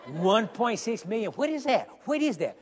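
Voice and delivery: a hint of a bewildered congregant; imitating a puzzled listener. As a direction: play the confused churchgoer, lightly. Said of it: "you don't say".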